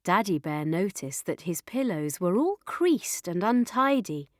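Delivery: ordinary delivery, nothing marked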